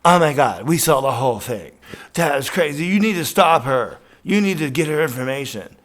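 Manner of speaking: loud, high, breathless gushing; mock panicky girls insisting you act; imitating hysterical onlookers shouting; frantic bystanders. Two women rushing up excited.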